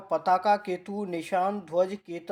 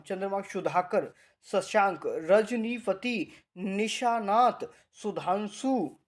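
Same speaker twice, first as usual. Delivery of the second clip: slow dictation; much slower than usual. Shown as a demonstration. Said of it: "slow dictation of word list".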